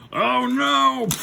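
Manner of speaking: hammed-up panic; mock dismay. Heroes to their attacker in a toy retelling, mock dismay. Heroes face enemy in retold story.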